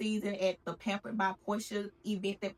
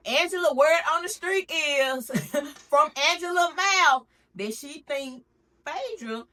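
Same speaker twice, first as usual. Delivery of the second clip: louder, higher gossip reveal; gossipy exclaim; 6 semitones higher, 7 dB louder than usual. Real, not acted. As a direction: spill the tea with glee. Spilling gossip loudly.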